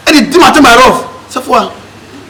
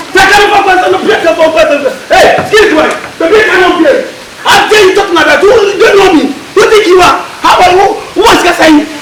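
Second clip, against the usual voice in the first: angry shouting; 7 semitones higher than usual. Acted. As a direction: shout him down, outraged. Acted quarrel in a skit.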